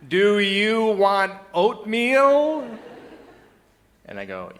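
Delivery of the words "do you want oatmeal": very high, loud, drawn-out slow syllables; hammed-up singsong morning greeting; toddler-level German speaker. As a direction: talk painfully slowly and loudly, as to a toddler. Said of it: "with a German-speaking toddler".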